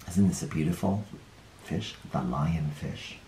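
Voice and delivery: soft, hushed wonder at the last fish. A narrator's reading. Showing children the last lonely fish, hushed. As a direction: hush as if showing a treasure. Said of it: "hushed over the last fish".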